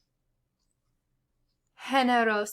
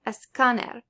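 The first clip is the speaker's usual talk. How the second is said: escáner stressed on CÁ, esCÁner; stress on accented syllable; 3 dB louder than usual. Shown as a demonstration. Teacher showing learners accent-mark stress, esCÁner. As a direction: punch the CÁ in escáner. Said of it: "where you're gonna place the emphasis".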